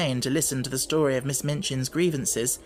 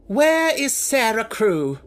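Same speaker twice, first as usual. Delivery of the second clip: slow, loud and higher; fierce demand; 8 semitones higher, 6 dB louder, slower than usual. Acted. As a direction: bite out each word fiercely. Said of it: "voice was almost fierce".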